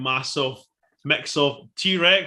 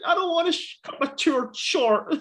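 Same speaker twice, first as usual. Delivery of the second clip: put-on whining wee kid; 12 semitones higher than usual. Mocking the film kid who refuses to go back, whiny and tearful. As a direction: imitate a little boy whinging. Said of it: "And the wee kid's just like".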